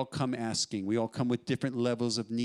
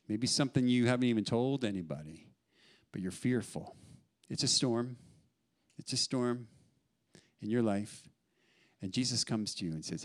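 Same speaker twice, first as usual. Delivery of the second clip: usual speaking voice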